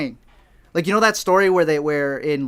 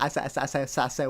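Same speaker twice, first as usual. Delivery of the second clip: flustered stammer; 3 semitones lower, 7 dB quieter, much faster than usual. Retold or imitated. Flustered retelling of being questioned.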